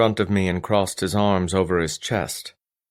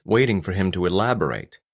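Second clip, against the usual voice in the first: ordinary delivery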